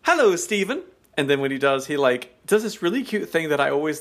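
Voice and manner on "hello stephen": higher, beaming hello; friendly greeting. Retold or imitated. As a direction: greet warmly with a handshake. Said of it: "reaches his hand out and says".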